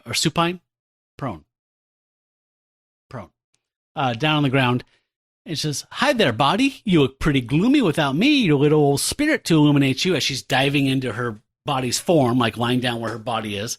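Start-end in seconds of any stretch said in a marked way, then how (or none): 5.9-10.1 hammed-up chirpy hello; playful greeting. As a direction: tease it brightly, getting louder. Cheerful spirit greets own body.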